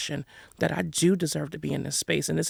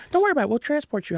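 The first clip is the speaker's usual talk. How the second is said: reassuring friend quoted, much higher; 8 semitones higher, 3 dB louder, much faster than usual. Retold or imitated. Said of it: "So what am I gonna do".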